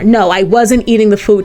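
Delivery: ordinary talk, nothing marked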